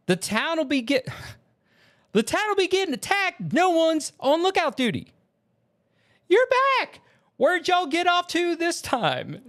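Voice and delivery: put-on very high, intense Southern accent. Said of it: "in the Southern accent".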